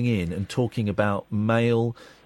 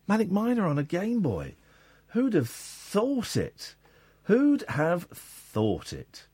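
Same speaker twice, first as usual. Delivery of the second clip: high, slow, drawn-out wonder; exaggerated marvelling; 8 semitones higher, slower than usual. Host marvelling at an old game on a Game Boy, drawn-out wonder. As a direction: swoop up high and stretch the words in amazement.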